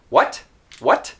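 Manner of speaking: "What" with a popped final t, a little angry; released T, slightly irritated. Shown as a demonstration. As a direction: let the t of "What" go sharply, as if irked. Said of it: "It sounds like I'm a little angry".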